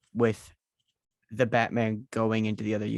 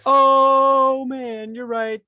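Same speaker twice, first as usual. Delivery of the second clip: mock surprise; 11 semitones higher, 8 dB louder, much slower than usual. Mock realization, jokey.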